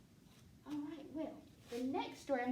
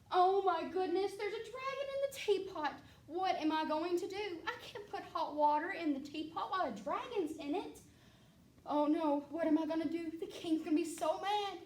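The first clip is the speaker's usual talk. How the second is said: high, loud flustered panic; fretting cook wringing her hands in alarm; panicked cook; 5 semitones higher, 4 dB louder than usual. Acted. Cook panicking about the dragon in the teapot, high and loud.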